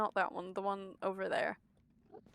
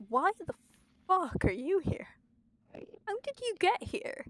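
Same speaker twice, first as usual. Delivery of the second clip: startled shout; 8 semitones higher than usual. Real, not acted. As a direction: yell it out, caught off guard. Shouting at mobs in game.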